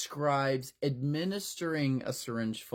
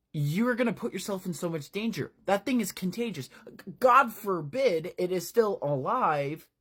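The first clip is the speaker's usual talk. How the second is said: worried warning; 7 semitones higher than usual. Acted. Adult warning the boys.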